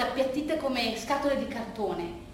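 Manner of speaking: ordinary delivery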